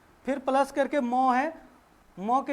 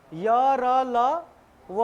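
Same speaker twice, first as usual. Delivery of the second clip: each letter spoken slowly and apart; slow alphabet recital; much slower than usual. Shown as a demonstration. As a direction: count the letters out one by one, very slowly. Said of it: "last में आते हैं".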